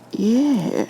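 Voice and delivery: a spooked cop reliving it; nervous officer Liu. A narrator's reading. Liu voice again.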